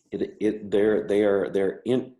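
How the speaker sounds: stammering on repeated words; stuttering. Real, not acted. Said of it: "so I'm a person who stutters".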